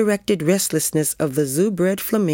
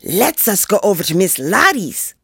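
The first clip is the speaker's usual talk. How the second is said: put-on higher kid's voice proposing mischief; excited boy; 4 semitones higher, 3 dB louder than usual. A narrator's reading. Joey proposing to annoy Miss Lottie, excited. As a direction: pitch the plan up high, like an eager child. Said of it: "his eyes sparkling".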